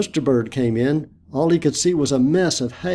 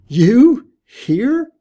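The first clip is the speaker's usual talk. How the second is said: loud, high-pitched disbelief; astonished gasp; 11 semitones higher, 10 dB louder than usual. A narrator's reading. Mister Bird finds his missing wife, astonished gasp. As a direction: burst out high and loud in shock. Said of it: "gasped Mister Bird".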